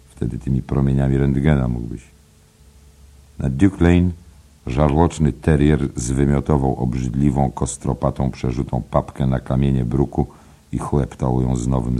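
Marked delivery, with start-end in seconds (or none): none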